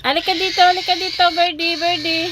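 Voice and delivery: high, loud call; calling the bird. Real, not acted. Owner calling Birdie to come, high loud call.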